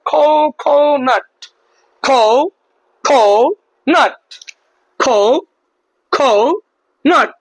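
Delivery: খো, খো, নাট as three separate beats; syllable by syllable. Shown as a demonstration. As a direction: pause after each খো, then নাট. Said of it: "তিনটা অংশে ভাগ করে বলতে পারলাম".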